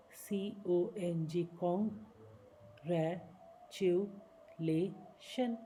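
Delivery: Congratulation drawn out very slowly in pieces; slow syllable by syllable. Shown as a demonstration. Teacher chunking congratulation slowly for students. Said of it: "छोटे छोटे हिस्सों में बांट लीजिए".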